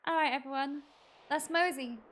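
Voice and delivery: normal talking voice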